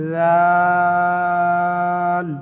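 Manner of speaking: long chanted দাল; drawn-out dal. Shown as a demonstration. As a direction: stretch দাল four alif lengths. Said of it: "চার আলিফ টান হবে".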